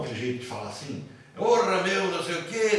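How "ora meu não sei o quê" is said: put-on loud, playful São Paulo street accent like Faustão; a hint of a São Paulo malandro accent; paulistano accent. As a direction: toss it off like a cocky Corinthians fan from São Paulo. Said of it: "meio Faustão".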